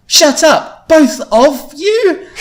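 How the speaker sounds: very high, loud shout, last words dragged. A narrator's reading. Louise intervening between her friends, drawn-out shout. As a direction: yell it and draw out the end. Retake of Louise's angry outburst.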